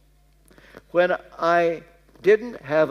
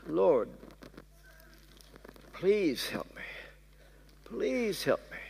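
pleading softly; 9 dB quieter than usual. Retold or imitated. Desperate prayer at desk.

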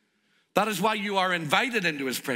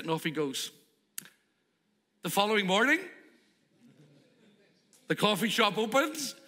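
ordinary talk, nothing marked

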